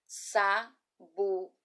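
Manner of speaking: Sabugosa split slowly into sa, bu, go, sa with gaps; syllable by syllable. Shown as a demonstration. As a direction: spell out Sabugosa one syllable at a time. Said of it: "Eu vou soletrar em seguida".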